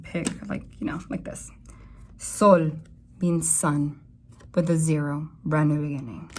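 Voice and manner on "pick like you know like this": whisper. Real, not acted. Aside while shuffling the cards.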